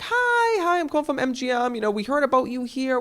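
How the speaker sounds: high, bubbly caller voice; impression of the MGM lady. Imitating the studio lady calling him, high and gushing. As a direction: gush like an eager studio rep. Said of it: "the woman gets on the phone and she's like".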